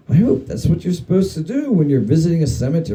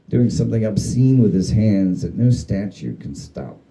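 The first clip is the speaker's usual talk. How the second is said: ordinary delivery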